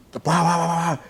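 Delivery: rapid high-pitched banging noises; imitated desperate banging. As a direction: mimic frantic pounding on doors. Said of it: "start banging desperately on both of them".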